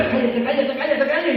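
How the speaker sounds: high, loud, repeated order to be silent; loud scolding. Acted. Crowd silencing the blind man, scolding loudly. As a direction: shut him up with insistent force.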